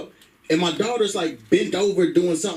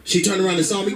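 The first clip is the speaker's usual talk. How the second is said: ordinary talk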